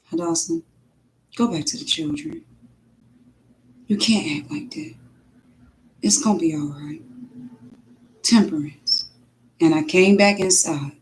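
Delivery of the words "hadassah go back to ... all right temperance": soft, calm voice of God; gentle reassurance, quiet; God answering her in a soft tone. Retold or imitated. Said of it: "just in a soft tone".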